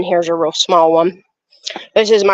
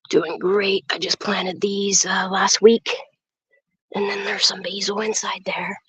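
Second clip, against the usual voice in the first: whispering; 6 dB quieter than usual. Real, not acted. Whispers to camera in garden.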